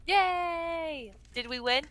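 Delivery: usual speaking voice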